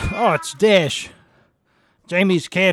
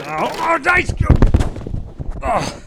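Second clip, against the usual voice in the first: high, startled squeal; startled yelp; 12 semitones higher, 4 dB louder, much slower than usual. Real, not acted. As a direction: shriek in shock at the cold. Startled by something cold.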